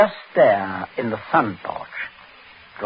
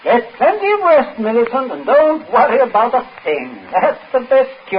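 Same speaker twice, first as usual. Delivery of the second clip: falsely cheerful; 10 semitones higher, 5 dB louder than usual. Acted. Ominous cheerful advice.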